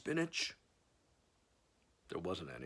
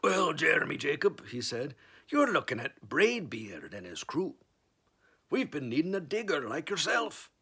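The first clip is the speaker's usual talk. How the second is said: pirate captain; 5 semitones higher, 4 dB louder than usual. Acted. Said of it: "Braidbeard speaking".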